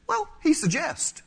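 high, drawn-out airy hedge; dismissive attitude. Acted. Reader treating God's commands as mere advice, dismissive attitude. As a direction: stretch it out high, as if it's merely optional. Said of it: "with this attitude that says".

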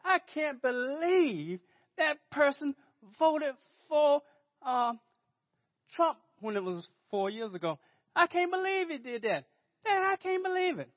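high-pitched, incredulous mock gossip; lightly voiced scandalized church member; mock churchgoer. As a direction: scoff at it in high disbelief. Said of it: "we're not supposed to say".

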